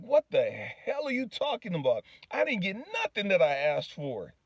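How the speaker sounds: high, indignant retort, re-enacted; heated protest. Man telling God he got nothing he asked for, heated. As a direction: argue back, pitch jumping up. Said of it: "I'm pissed. I'm heated now".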